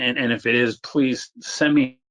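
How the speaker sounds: usual speaking voice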